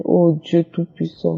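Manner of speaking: ordinary delivery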